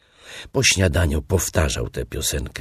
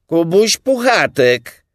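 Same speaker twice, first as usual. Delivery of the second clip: high, loud, slow announcement; high, drawn-out holler; calling out; 10 dB louder than usual. A narrator's reading. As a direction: shout your name into the burrow. Pooh shouts own name back.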